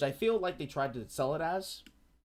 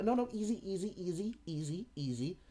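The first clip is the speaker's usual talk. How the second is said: calming reassurance; 5 semitones higher than usual. Retold or imitated. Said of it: "It's like".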